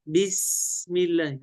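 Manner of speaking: slow بسم with a long, clean hissed س; precise sin. Shown as a demonstration. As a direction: demonstrate a held 's' in بسم الله. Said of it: "هكذا، لكن هو لم يضبط السين". (بسم الله ببطء مع سين مضبوطة)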